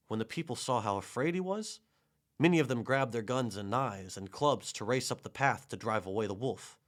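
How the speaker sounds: ordinary delivery, nothing marked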